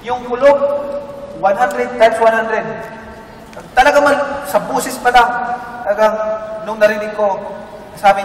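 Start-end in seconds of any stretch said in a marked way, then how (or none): none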